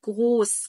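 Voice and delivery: جروس with a hard g and long o; hard G sound. Shown as a demonstration. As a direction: model جروس loudly, German "groß". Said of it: "بيبقى صوت الجيم". (نطق جروس بجيم مصرية)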